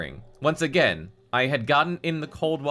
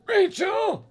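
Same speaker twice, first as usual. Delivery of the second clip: calling out. Acted. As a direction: yell her name from afar. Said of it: "a hoarse voice called out".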